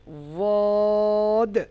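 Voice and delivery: ওয়াদ held slow and full; a slow, thick ওয়াদ; heavy dad. Shown as a demonstration. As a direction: hold the ওয়াদ heavy and long. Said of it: "মাখরাজে জমিয়ে মোটা আওয়াজে".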